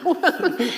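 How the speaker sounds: a high burst of laughter; high giddy laugh. Real, not acted. Laughs at landing a book deal.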